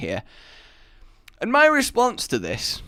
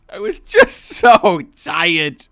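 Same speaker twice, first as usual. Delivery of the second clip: loud, slow, laughing emphasis; laughing exasperation; 3 semitones higher, 8 dB louder, slower than usual. Real, not acted. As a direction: plead it through laughter, drawn out. Laughing plea to the audience.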